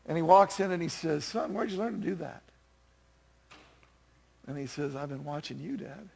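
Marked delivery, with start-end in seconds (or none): 1.2-2.4 hushed dad. Acted. Dad finds son praying in song. 4.9-6.1 put-on soft, low-key boy's reply; quiet, heartfelt confession to Dad; tender son. Praying son's tender reply in song.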